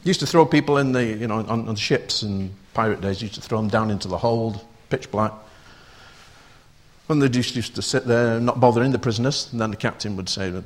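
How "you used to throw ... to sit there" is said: normal talking voice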